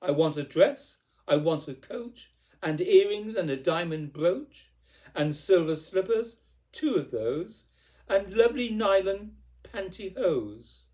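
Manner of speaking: spoilt, emphatic clamouring; demanding tantrum. A narrator's reading. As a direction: insist on every item, petulant and forceful. Cindy's shouted tantrum of demands continues.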